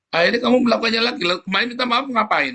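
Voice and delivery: preacher rebuking a careless apologizer, exasperated. Retold or imitated. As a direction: throw it back at them, fed up.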